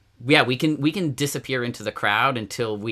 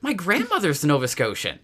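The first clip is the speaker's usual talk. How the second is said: a hint of an excited clerk, higher; lightly voiced clerk; 8 semitones higher than usual. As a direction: imitate the hotel clerk, pitch raised.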